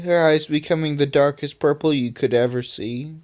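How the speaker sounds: ordinary delivery, nothing marked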